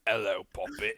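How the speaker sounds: quick mock British greeting; light British accent. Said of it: "start every sentence with".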